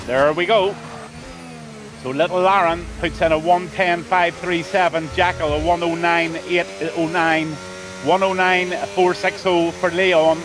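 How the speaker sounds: normal talking voice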